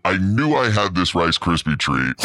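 usual speaking voice

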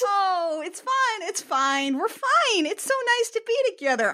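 high, forced cheerful reassurance; putting on a brave face. Retold or imitated. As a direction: smile through the panic. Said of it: "putting on a brave face".

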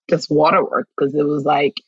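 usual speaking voice

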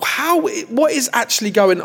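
high baffled outburst. Retold or imitated. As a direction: ask in disbelief, pitched high. Reacting to low view counts.

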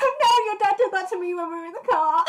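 high, loud, through laughter; laughing protest. Real, not acted. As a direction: burst in laughing with the memory. Laughing as she recalls teasing story.

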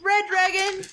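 high, laughing mock concern; mock caring voice. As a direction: play a fussy caretaker through laughter.

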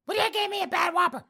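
put-on high, loud huffy old lady; old woman voice. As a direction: squawk it out like an outraged old lady.

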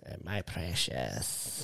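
low, slow, creepy creature croak; Smeagol impression. Impersonating Smeagol for a podcast co-host. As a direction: hiss it out like Smeagol.

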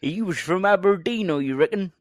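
loud, high mock West Country; hammed-up West Country yokel; West Country accent. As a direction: exaggerate a West Country burr for laughs. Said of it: "Aberdeen in a West Country accent".

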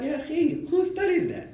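high, squeaky mock disbelief; mock incredulous. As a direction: scoff like nobody could know that. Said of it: "you'd be like".

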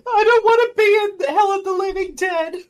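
high, loud hammed-up wail; exaggerated tearful whine; mock crying. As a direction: wail like a miserable actor, playfully. Playing a miserable actor in film.

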